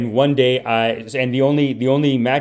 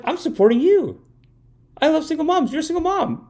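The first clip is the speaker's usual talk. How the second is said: mock pleading, voice pushed way up; comically high self-defense to an angry mother; high-pitched protest. Acted protest to his angry mom.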